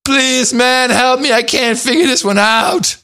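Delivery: high, strained mock plea for help; pleading tweeter. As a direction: whine it out high, like a desperate listener begging. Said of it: "people are tweeting me".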